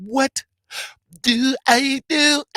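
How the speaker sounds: high, slow, drawn-out squeal; catchphrase squeal. Acted. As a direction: stretch it out, way up high. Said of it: "To everybody that be living it up".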